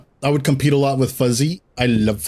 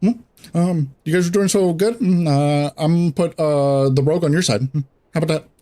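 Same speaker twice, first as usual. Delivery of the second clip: teasing raid leader; 4 semitones higher than usual. Retold or imitated. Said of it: "Bubba would be like".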